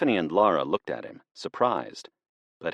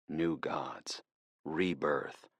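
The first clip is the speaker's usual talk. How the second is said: whispered, much softer; hushed echo; 2 semitones lower, 9 dB quieter, much slower than usual. A narrator's reading. Narrator echoing the attackers' words, whispered and ominous. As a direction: let the words hang like a dark realisation.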